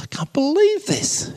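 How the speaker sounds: high, squeaky disbelief; astonished. Retold or imitated. Rabbi seeing second priest walk on water, squeaky astonishment.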